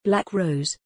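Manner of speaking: plain reading voice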